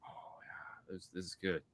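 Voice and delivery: very soft, hushed imitation; absorbed child. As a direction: murmur like a kid lost in the show. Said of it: "and he was like".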